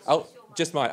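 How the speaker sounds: ordinary talk, nothing marked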